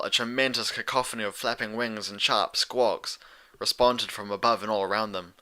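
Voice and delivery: ordinary delivery, nothing marked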